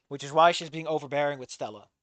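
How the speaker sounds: ordinary delivery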